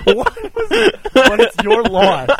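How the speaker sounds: laughing mimic. Laughing mimic of caller's line.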